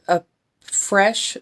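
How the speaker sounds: usual speaking voice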